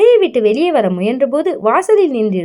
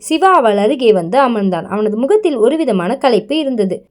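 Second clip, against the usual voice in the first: ordinary delivery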